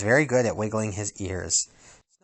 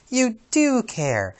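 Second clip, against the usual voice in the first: high, slow tender coo; 12 semitones higher, much slower than usual. Acted. Princess tenderly teases grumpy dwarf. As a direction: melt at his kindness.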